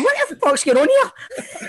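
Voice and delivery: high, slow, laughing through it; baffled and cracking up; laughing disbelief. Retold or imitated. Mocking a bewildered teammate.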